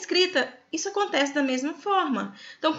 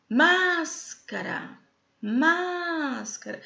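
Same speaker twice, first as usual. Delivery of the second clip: MÁScara called out, first syllable held very long and high; calling the word; 4 semitones higher, much slower than usual. Shown as a demonstration. Teacher showing students how to find the stressed syllable, calling out MÁScara slowly. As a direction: stretch the MÁS in "Máscara" way out, then let "cara" drop. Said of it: "chama a palavrinha".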